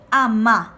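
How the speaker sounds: "ama" said loudly as a-MA; loud model "ama", weight on its final syllable; stress on final A. Shown as a demonstration. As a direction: load the tone onto the last A of "ama". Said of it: "cargo acá el tono en la última A".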